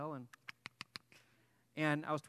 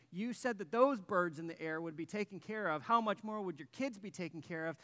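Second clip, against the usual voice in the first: loud, higher, accusing plea; retold yelling at God; 5 semitones higher, 7 dB louder than usual. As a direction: re-enact a desperate rant at God. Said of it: "And I'm yelling, screaming, cursing".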